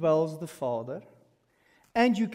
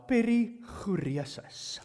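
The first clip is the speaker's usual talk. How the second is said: perichoresis with an Afrikaans rolled r and a throaty ch, slow; Afrikaans accent; 3 semitones higher, slower than usual. Shown as a demonstration. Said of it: "with Afrikaans accent now".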